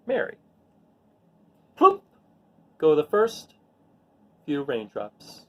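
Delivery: put-on gentle storybook reading voice for children; read-aloud narration. A narrator's reading. Teacher reading a rain picture book to her students, gentle storytelling voice. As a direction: read it like story time to a class.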